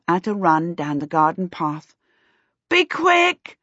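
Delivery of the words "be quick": much higher, louder urgent call; a boy calls after the girl running off; shout. A narrator's reading. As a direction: yell it after her as she runs. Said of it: "Peter shouted after her".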